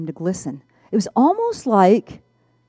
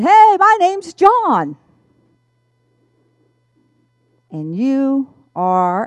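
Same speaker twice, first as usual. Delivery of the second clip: slow, high, loud hearty hello; friendly greeter; 5 semitones higher, 6 dB louder, much slower than usual. Retold or imitated. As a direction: greet her slowly and warmly. John greets her in story.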